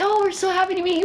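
high, fast, overly delighted parents greeting; sugary welcome from eager parents; gushing parents. Retold or imitated. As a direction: play the parents thrilled to meet him. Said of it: "and they're like".

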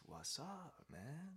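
high, soft-voiced laid-back koala; stoned koala. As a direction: greet lazily as a mellow stoner koala. Stoned koala greets the lizard.

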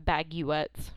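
"baguettes" as bag-you-ettes; deliberate mispronunciation. Shown as a demonstration. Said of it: "If you don't order it like that".

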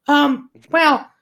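high, fast little bat voice. Acted. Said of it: "he flies back and says".

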